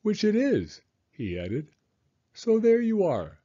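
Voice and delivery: high, chirpy bear voice; pleased little bear. Acted. Pooh talks to himself on the way, high and pleased. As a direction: sound proud of having settled it.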